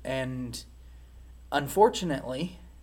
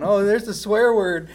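higher, louder mock excitement; excited kid's discovery; 6 semitones higher, 10 dB louder than usual. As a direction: imitate a kid thrilled at a naughty find. Child delighted at finding swear word.

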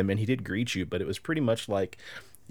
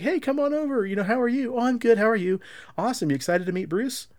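much higher, eager friendly voice; mock chirpy handler; 12 semitones higher, 3 dB louder than usual. Handler inviting the wife over, high and chirpy. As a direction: play a bubbly handler waving her over.

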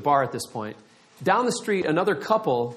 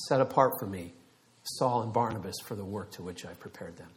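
low, soft voice of God; God speaking softly; 7 semitones lower, 8 dB quieter than usual. Retold or imitated. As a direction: lower your voice as God calling someone aside. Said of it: "God's going like this".

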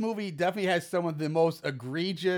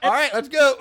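a louder, bright-eyed yes; eager mock agreement; 6 semitones higher, 8 dB louder than usual. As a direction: jump at it like a keen partner. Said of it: "and she's like".

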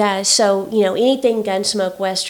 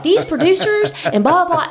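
mock fury; 7 semitones higher than usual. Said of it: "And I am furious".

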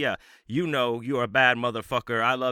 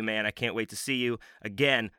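ordinary talk

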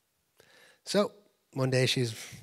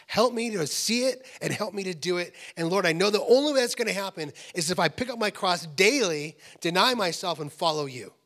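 building, higher and louder plea to God; fervent prayer; 5 semitones higher, 6 dB louder than usual. Shown as a demonstration. Preacher modelling a self-emptying prayer to the Lord, fervently. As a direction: pray with rising force and pitch.

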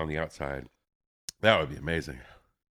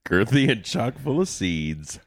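suggestive; 6 semitones higher, 5 dB louder, slower than usual. Real, not acted. Teasing echo of innuendo.

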